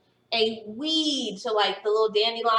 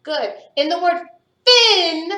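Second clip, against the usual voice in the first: ordinary delivery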